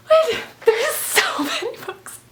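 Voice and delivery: high, slow, giggling amazement; laughing disbelief. Real, not acted. Vlogger seeing the stack of books, laughing. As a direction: giggle it out, stunned. Pile of gifts.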